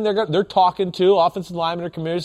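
ordinary talk, nothing marked

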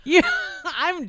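high, loud burst breaking into laughter; laughing protest. Real, not acted. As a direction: laugh while insisting, slowing down. Laughing mid banter about the drama.

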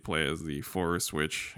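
ordinary talk, nothing marked

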